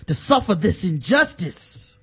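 slow, preachy Ray Lewis sermon tone. Pretend. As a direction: intone it like a preacher scolding. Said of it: "Ray Lewis, go ahead and talk to him".

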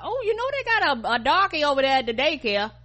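high, loud mocking voice; hammed-up nosy neighbour imitation. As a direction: pitch it way up as a scandalized local. Voicing a gossiping racist neighbour.

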